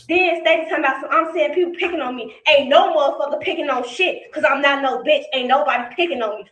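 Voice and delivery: ordinary delivery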